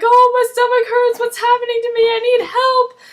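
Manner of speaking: high, loud hammed-up wail of pain; mock agonized cry. Recapper voicing the sick man screaming in pain. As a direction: shriek it like the stomach is tearing. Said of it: "and he's like".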